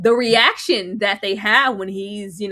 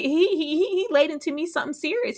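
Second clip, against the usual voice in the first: usual speaking voice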